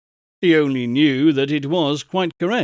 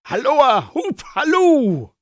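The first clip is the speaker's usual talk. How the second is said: higher, louder hallooing; calling out; 9 semitones higher, 4 dB louder than usual. A narrator's reading. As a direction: hail him across a field. Calling after Friday.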